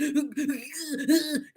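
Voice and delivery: a quick laugh at herself; brief embarrassed laugh; short real laugh. Real, not acted. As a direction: laugh it off lightly. Laughs about crying snot and all.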